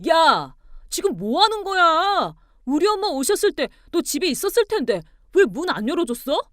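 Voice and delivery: high, loud angry demand. A narrator's reading. Husband phoning wife about his mother, furious. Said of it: "목소리가 화가 잔뜩 나 있었어요".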